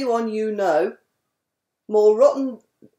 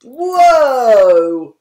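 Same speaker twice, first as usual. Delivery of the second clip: surprised whoa; 11 dB louder than usual. Acted. The big jump into tub.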